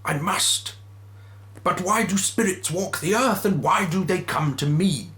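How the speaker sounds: lightly voiced Scrooge. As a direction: concede it nervously. Scrooge questions the ghost.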